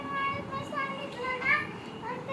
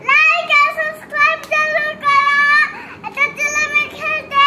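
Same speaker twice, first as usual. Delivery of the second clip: very loud, higher-pitched outro; shouted sign-off; 4 semitones higher, 14 dB louder than usual. Real, not acted. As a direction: belt out the plea to viewers. Child shouts out the vlog sign-off.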